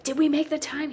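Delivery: high-pitched anxious question, retold; retold panic. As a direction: ask nervously, pitch lifted, re-enacting the worry. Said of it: "I was panicking that we weren't gonna make the time".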